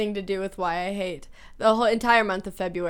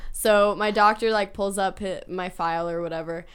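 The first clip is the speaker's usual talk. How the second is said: ordinary talk, nothing marked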